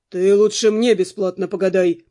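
1 insistent guard. A narrator's reading. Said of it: "не уступал стражник".

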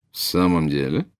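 cool question with rising end; faint doubt. A narrator's reading. Snape questioning Bellatrix's boast, faintly doubtful. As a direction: let the slightest scepticism show. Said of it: "прозвучал легчайший намёк на сомнение".

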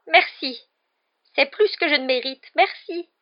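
high, loud, effusive thanks; babbling gratitude. A narrator's reading. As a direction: grovel with relief. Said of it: "balbutia Petit Gros". (remerciements éperdus)

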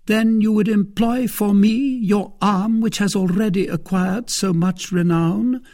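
high, loud, insistent lady's voice; Milady, flattering. A narrator's reading. Lady enlisting renowned suitor's arm, high and flattering. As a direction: coax a champion with praise.

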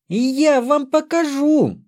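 высокий, протяжный детский выкрик; пригрози братьям тонким детским криком; угроза рассерженной девочки. A narrator's reading. Девочка бросается мстить братьям. (воинственный детский возглас)

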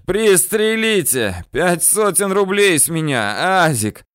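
high-pitched, insistent yell. A narrator's reading. Bandit chief offers his man money to shoot, yelling. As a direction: yell out a desperate bribe. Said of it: "орёт Ахмед".

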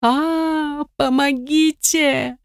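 high, slow desperate cry for help; terrified scream. Acted. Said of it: "закричала".

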